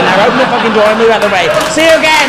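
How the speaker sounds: fast, loud, cheeky send-off; mock English driver brushing off the Dutch driver; rude chauffeur. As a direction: rattle it off and shout the goodbye. English driver telling him off.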